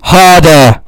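yelled at full volume; shouting. Real, not acted. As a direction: bellow it out. Urging caller to hit phone harder.

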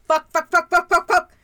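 frantic loud "fuck, fuck, fuck"; loud panicked swearing. Acted. Panicked swearing before call.

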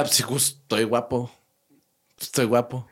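a lazy, pleased-with-himself answer; smug reply. Pretend. Answering a flattering salesman with smug calm. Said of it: "Yo diría".

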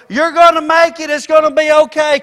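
high, loud shout of hope; screamed encouragement. Acted. Someone on the shore screaming to a person in the storm, loud and high. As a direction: yell it from the shore to a sinking boat. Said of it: "scream out and go".

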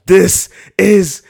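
battle cry in a grocery store; mock Leonidas bellow. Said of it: "if you at the store".

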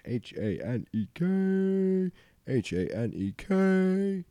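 sing-song each letter of the code slowly; spelled out. Acted.

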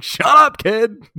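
loud, high exasperated yell; mock shout. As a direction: snap at the annoying kid. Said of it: "It's like".

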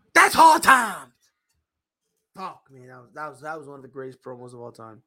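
ordinary talk, nothing marked

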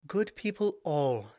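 high, slow, ringing proclamation; clear ringing tones. A narrator's reading. Princess addressing her people in clear ringing tones. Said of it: "she said in clear, ringing tones".

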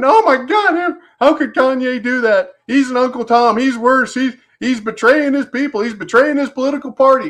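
high-pitched, hammed-up whining outrage; mock whiners. Host mocking leftist whiners over [name], high whiny voice. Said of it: "you can just hear the leftist whiners going".

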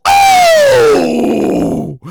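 loud, high drawn-out ooh; high whoop. Acted. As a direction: hoot in scandalized delight. Whooping over petty level.

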